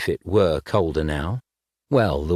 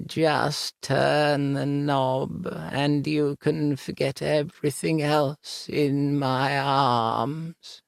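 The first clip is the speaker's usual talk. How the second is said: whispery ghost voice; 6 semitones higher, much slower than usual. Acted. Ghost seducing through the door.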